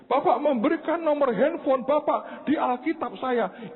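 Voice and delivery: high-pitched, insistent caller voice; imitated crying. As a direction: imitate a tearful woman reminding the pastor. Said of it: "dia nangis keras". (menirukan ibu yang memelas)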